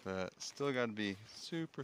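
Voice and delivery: normal talking voice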